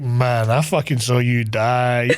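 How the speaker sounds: low, drawn-out disbelief; stunned disbelief. Retold or imitated. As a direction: stretch it out slowly in mock astonishment. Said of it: "everyone's looking at him like".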